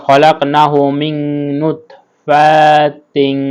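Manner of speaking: নুতফাতিন very slow with a held nasal n; nasal ghunnah. Shown as a demonstration. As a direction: hum the n in মিন নুতফাতিন. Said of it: "গুণনা করতে হবে".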